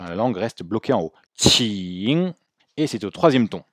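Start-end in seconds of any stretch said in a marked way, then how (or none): 1.4-2.3 QING with a long, fading nasal "ng"; airy start, lingering "ing", rising end; ng dying in throat. Shown as a demonstration. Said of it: "meurt dans la gorge".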